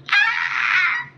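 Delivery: very high happy squeal; delighted squeal. Real, not acted. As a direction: squeal like you just got a gift.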